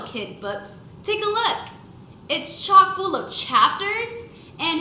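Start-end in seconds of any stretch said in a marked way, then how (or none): 1.0-1.6 higher, eager offer; proud invitation. A narrator's reading. Proud kid shows book. 2.3-4.3 higher, eager offer; proud invitation. A narrator's reading. Proud kid shows book.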